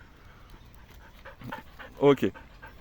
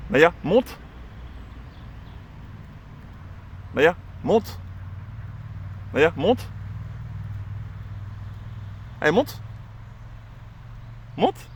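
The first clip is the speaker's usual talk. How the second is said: monte called out higher each time; high command to dog; 6 semitones higher, 5 dB quieter, slower than usual. Real, not acted. Trainer repeating the climb order to Naya, rising high. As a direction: keep calling her up, higher and higher.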